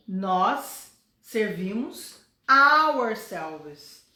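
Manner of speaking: teacher lands on ourselves; slow, stressed ourselves. Shown as a demonstration. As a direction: spell out ourselves for the class. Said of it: "We e ourselves".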